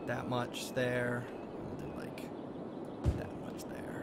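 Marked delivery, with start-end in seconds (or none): 1.8-4.0 whisper. Pretend. Repeated in a whisper.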